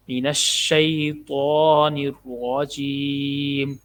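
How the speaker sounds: الشیطان with a thick heavy ط, slowly. Shown as a demonstration. Teacher showing the heavy ta, slow recitation. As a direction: make the ط in الشیطان full and heavy. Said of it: "تو کو موٹا پڑھنا ہے".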